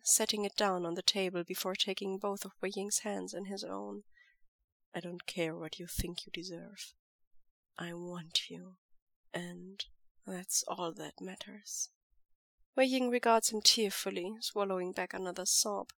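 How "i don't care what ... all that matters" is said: soft firm lover's voice; quiet devoted lover. Acted. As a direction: hold him close with quiet certainty. Lan Zhan reassures.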